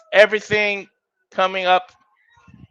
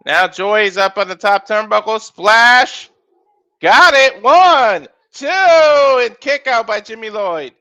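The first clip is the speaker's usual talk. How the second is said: rapid ringside calls rising on big moves; live play-by-play; 5 semitones higher, 6 dB louder than usual. Acted. Commentator calling a wrestling match to viewers, energetic play-by-play. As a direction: call the match as it happens.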